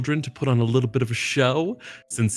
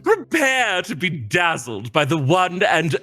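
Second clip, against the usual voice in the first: announcer showman; 7 semitones higher than usual. Acted. Player in character addressing the party kids, as showman. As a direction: present yourself like a stage show host. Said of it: "you say this in a very announcement fashion".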